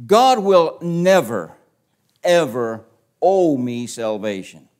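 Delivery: NEVER hammered loud and slow; slow, loud, drawn-out never; emphatic preaching. Shown as a demonstration. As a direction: pound each word home. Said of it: "Listen carefully".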